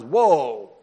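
loud, laughing exclamation of surprise; loud retold whoa. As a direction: burst out with a mock astonished whoa. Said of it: "Elijah's response was".

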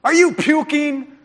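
high, loud, slow questioning; drill-sergeant bark. Retold or imitated. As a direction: grill the kid like a tough coach. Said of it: "And then I did this".

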